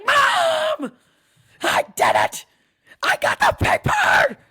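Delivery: high, loud excited shout; excited kid shouting. Retold or imitated. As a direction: scream it like a thrilled child. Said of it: "run out screaming".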